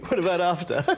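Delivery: laughing. Real, not acted. Teller laughing at own joke.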